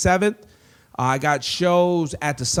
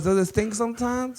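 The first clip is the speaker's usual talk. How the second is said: Mauricia; 8 semitones higher than usual. Retold or imitated.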